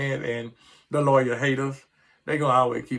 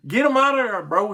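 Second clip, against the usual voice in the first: yelled high and loud, fed up; high, loud, angry rant against the coach; exasperated shout; 11 semitones higher, 7 dB louder than usual. Real, not acted. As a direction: shout it like a furious fan who has had enough. Fan ranting at coordinator.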